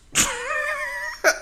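high, loud laugh; burst of laughter. Real, not acted. Comic cracks up after losing his flow, high loud laugh. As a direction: crack up at your own slip.

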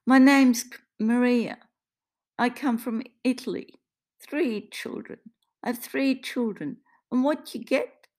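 put-on high, emphatic accented lament; wounded, indignant Italian-accented voice; aggrieved Italian woman. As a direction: protest bitterly at how life turned out. Maria bitterly tells her life story.

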